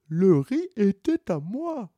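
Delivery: mild stubborn tortoise; lightly voiced tortoise. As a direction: insist on your claim. Tortoise argues in court.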